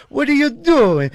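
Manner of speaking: put-on high, slow scolding old lady; lightly voiced old Italian lady. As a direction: mimic the neighbor lady yelling. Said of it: "this old Italian lady goes".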